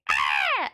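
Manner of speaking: low raspy owl screech; barn owl screech. Retold or imitated. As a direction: imitate a demonic barn owl cry. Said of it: "They go".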